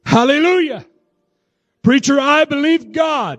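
high-pitched preacher's cry of praise; fervent exclamation. Real, not acted. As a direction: call it out high with full conviction. Preacher exclaims faith to congregation.

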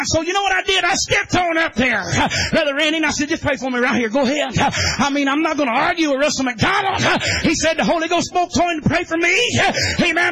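chanted preacher's cadence, half shouted; whooping holiness sermon; preaching at full force. Real, not acted. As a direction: drive it home like a revival preacher.

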